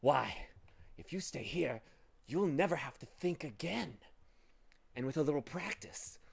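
lightly wheedling, coaxing villain; pleasing, deceitful voice. A narrator's reading. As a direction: lure them in with false sweetness. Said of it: "soft, pleasing, deceitful voice".

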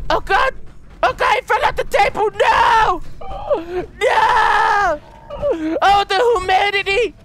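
very high, loud wailing cries; melodramatic anguish. Pretend. Player mourning teddy fallen off the table, melodramatic anguish. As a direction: wail in hammed-up grief.

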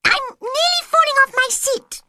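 very high, loud squeaky complaint; cross Dormouse. A narrator's reading. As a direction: squeal it like a cross little animal. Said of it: "said the Dormouse crossly".

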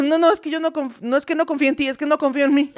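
defensa aguda y atropellada; justificación apurada. Acted. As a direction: se excusa nerviosa, en tono de broma. Se justifica ante la amiga ofendida. (disculpa exagerada en voz aguda)

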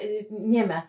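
ordinary talk

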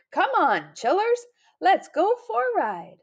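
high, eager invitation; excited call to adventure; rallying the kids. A narrator's reading. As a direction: cheer them on to join in. Granddude rallies children for a ride.

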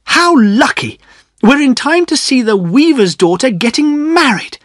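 gushing over the wedding; a high, thrilled voice; Priscilla excited. A narrator's reading. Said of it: "cried Priscilla".

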